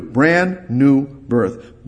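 very slow, brand held long; drawn out. Acted. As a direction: stretch out brand new birth. Slowed emphatic phrase.

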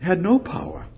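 ordinary talk, nothing marked

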